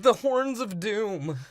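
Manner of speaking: hammed-up portentous announcement; mock ominous. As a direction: intone it like an overblown prophecy of doom. Mock-announces the movie's doom device.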